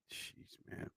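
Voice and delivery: whispered exasperation. Real, not acted. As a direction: breathe it out under the breath. Exasperated aside.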